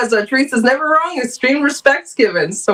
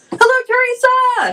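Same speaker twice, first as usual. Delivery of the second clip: high sing-out hello; called-out greeting; 11 semitones higher than usual. Real, not acted. Streamer welcomes a viewer to the chat, calling out high. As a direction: hail them in a high, held voice.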